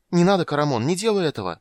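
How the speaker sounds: high, loud, alarmed shout; frightened cry. A narrator's reading. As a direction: cry out in fright to stop them. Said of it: "испуганно крикнула жрица". (испуганный крик)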